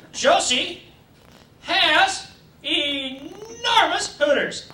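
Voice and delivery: drawn-out, very slow dictation. Pretend. Teacher slowly dictating to class, drawn out. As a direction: lay each word down slowly for the class.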